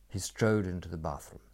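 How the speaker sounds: ordinary delivery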